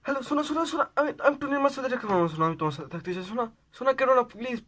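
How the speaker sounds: high-pitched, rushed begging; panicked, tumbling plea to a lover; frantic pleading. Acted. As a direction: plead desperately before the line cuts. Begging partner not to break up.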